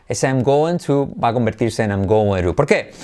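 ordinary talk

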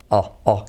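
O, O as a dark L vowel; dark L sound. Shown as a demonstration. Teacher isolates the dark L sound for students. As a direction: hold the dark O-like L. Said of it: "en inglés es O".

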